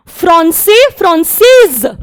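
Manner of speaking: फ्रांसे without s, then फ्रांसेज with a z; masculine then feminine. Shown as a demonstration. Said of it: "थोड़ा सा pronunciation में difference है ना".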